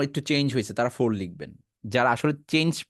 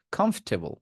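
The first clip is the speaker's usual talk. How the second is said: usual speaking voice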